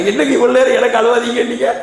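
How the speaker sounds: high, fast, wailing cry; wailing boss. Acted. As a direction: cry it out in sudden grief. Boss wails over his son.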